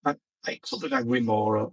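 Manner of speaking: normal talking voice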